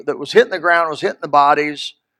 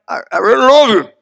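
halting husband forcing out "I really love you"; hesitant first try; 4 semitones higher, 4 dB louder than usual. Retold or imitated. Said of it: "at the first, you gotta say it like".

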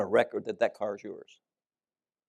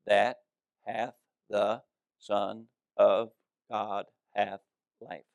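slowly, every word weighed; slow emphatic reading; 4 dB quieter, slower than usual. Shown as a demonstration. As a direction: read each one-syllable word slowly and deliberately. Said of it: "I'm gonna say it real slow so I can emphasize".

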